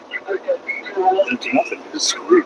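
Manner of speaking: high, slow, thin whine fading to a whisper; hammed-up whimper; mock whine. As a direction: whimper it out, trailing off breathy. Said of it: "It's like".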